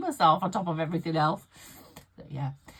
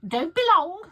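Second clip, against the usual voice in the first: much higher and louder, bossy; mock bossy mum; 13 semitones higher, 11 dB louder than usual. Mum telling her daughter to hurry back, high bossy mock. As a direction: snap it like a demanding mum.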